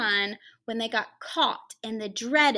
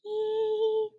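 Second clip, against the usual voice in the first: sung note; 10 semitones higher than usual. Retold or imitated. Imitated musical note.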